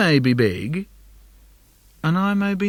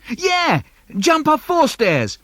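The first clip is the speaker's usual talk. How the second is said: put-on much higher, slower kid squeal; excited little brother; 12 semitones higher, 4 dB louder, slower than usual. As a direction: egg him on, thrilled. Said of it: "He says".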